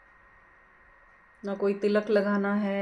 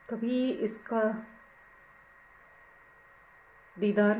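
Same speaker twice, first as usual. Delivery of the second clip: guided meditation; 4 dB quieter than usual. Acted. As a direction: ease them gently into watching the breath, unhurried. Leads a breath meditation. (धीमी, कोमल ध्यान कराने वाली आवाज़)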